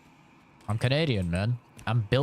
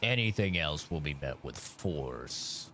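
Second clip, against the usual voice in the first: menacing; 6 semitones lower, 7 dB quieter than usual. Pretend. Menacing line read.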